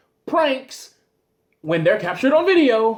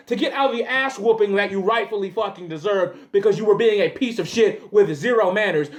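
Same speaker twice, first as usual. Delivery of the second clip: ordinary talk, nothing marked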